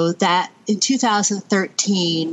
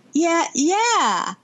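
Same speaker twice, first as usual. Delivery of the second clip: excited son; 10 semitones higher than usual. Retold or imitated. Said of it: "And I was like".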